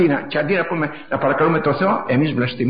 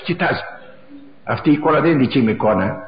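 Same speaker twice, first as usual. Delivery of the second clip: ordinary talk, nothing marked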